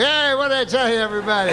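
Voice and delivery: high, crowing mock boast; mock triumphant call. Comic as judge showing off to everybody, triumphant call.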